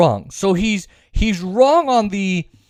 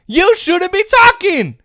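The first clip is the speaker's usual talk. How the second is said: angry repeat; 11 semitones higher, 3 dB louder, slower than usual. Real, not acted. Repeats the rant line.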